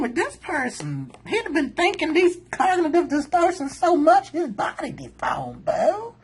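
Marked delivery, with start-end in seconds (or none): none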